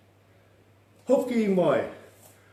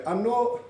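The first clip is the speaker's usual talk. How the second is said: "Anō" pitched high, rising; "Anō" as a high questioning lift; voice going up; 6 semitones higher than usual. Shown as a demonstration. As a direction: call "Anō" upward, asking for a repeat. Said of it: "Hear the voice go up".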